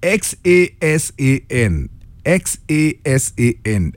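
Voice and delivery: slow, letter by letter; spelling out a name. Real, not acted. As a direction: spell each letter separately and slowly. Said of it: "Ebu pronounce this name".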